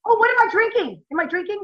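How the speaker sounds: high, surprised realization. Real, not acted. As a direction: caught off guard, pitch up. Caught off guard by question.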